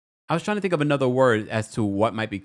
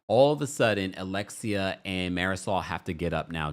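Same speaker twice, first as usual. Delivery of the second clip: normal talking voice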